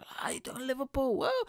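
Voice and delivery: comic opening his set to a flat room; hyped stage opener. Retold or imitated. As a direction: fire up a sleepy crowd. His own stage opener to crowd.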